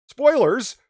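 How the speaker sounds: high, loud one-word alarm; sudden playful holler; shouted spoiler warning. Pretend. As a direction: call it out as a mock alert.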